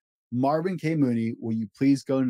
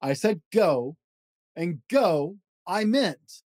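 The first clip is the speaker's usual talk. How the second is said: lively read-aloud of a rhyming picture book; storybook voice, playful and rhythmic; reading to children; 5 semitones higher than usual. Acted. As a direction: bounce through the rhymes for a child at bedtime. Reads Dr. Seuss book aloud.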